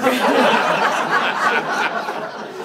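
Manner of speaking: long loud laugh; real laughter. Real, not acted. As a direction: laugh hard.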